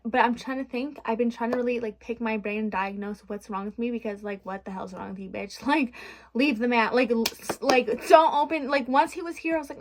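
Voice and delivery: usual speaking voice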